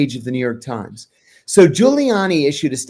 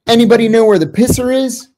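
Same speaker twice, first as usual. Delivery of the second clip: mock Giuliani brush-off; 8 semitones higher, 5 dB louder, faster than usual. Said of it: "And he goes like this".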